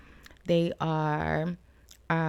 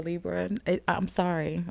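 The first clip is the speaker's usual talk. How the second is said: ordinary delivery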